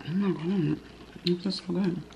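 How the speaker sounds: usual speaking voice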